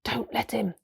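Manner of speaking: urgent whispered warning; hushed, breath-only aside. A narrator's reading. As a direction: whisper it aside to your leader. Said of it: "whispered Trumpkin to Peter".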